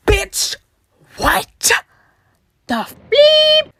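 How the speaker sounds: high, loud mock outrage; outraged retort. Girl retelling her reply to a rude boy, outraged. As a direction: burst out loud and incredulous. Retold outraged comeback to him.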